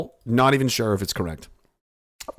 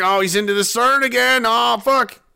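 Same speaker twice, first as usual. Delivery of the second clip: high, loud mock panic; panicked official, hammed up; 11 semitones higher, 5 dB louder than usual. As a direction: squeal in hammed-up alarm.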